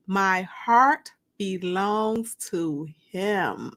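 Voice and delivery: ordinary delivery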